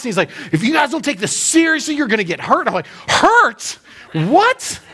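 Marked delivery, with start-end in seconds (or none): 0.5-2.7 raised, higher-pitched stern warning, not a full yell; mock drill sergeant yelling. River guide warns the youth group, mock drill-sergeant bark. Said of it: "he was, like, yelling at us". 3.1-4.8 high-pitched incredulous yelp; mock alarmed disbelief. Youth pastor reacts to the guide's warning, hammed-up shock.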